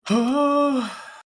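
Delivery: high, loud theatrical sigh; loud exaggerated sigh. Real, not acted. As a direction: let out a big mock-weary sigh. Loud sigh after joke.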